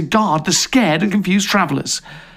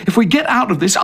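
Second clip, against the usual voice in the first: ordinary delivery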